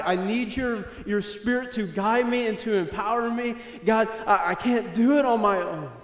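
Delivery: impassioned plea to God. Acted. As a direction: plead with God, building from firm to urgent. Acting David's desperate plea to God.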